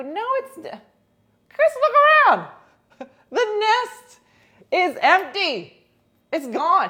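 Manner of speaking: high, loud mock-exasperated protest; exasperated mock shout. Arguing with best friend over empty nest, mock shouting. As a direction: yell it at a friend who won't get it, playing it up. Acted quarrel with her friend.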